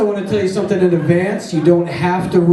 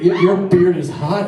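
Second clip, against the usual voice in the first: ordinary talk, nothing marked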